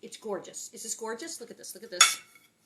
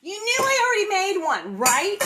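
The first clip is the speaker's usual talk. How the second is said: teasing; 10 semitones higher, 9 dB louder than usual. Real, not acted. Teasing viewers about premade cake.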